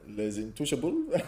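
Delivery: slow, put-on French accent. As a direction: say the title like a French speaker. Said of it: "Please say it in the French accent".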